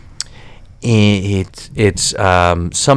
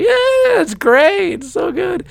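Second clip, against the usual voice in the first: much higher, hammed-up sitcom gushing; sitcom fake enthusiasm; slower than usual. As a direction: play it like a Seinfeld character. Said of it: "I felt like a character".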